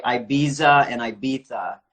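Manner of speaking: first Ibiza with a z, second Ibiza with a th; two ways of saying Ibiza. Shown as a demonstration. Host asks guest which is correct, Ibiza with z, then th. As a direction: contrast Ibiza as eye-BEE-za, then eye-BEE-tha. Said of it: "correct pronunciation?".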